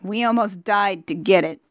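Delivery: plain reading voice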